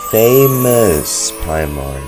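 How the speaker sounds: ordinary talk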